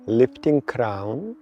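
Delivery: ordinary talk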